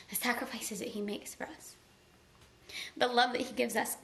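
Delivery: ordinary talk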